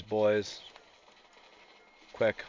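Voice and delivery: normal talking voice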